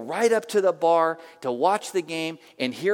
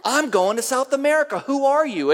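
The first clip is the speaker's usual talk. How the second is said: high, loud excited exclamation; amazed outburst; 9 semitones higher, 7 dB louder than usual. Retold or imitated. Bartender amazed at the pastor's help, excited outburst. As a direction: cry it out in giddy disbelief.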